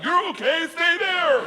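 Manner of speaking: high, loud shout; comic yell, pitch way up; mock barked order. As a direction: imitate the cop's bark with English words. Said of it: "it doesn't sound like".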